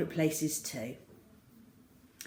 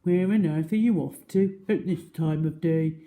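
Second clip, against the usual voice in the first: louder booming hippo query; 5 dB louder than usual. Acted. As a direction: ask with big curiosity. Hippo questions crocodile.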